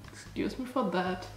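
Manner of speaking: ordinary talk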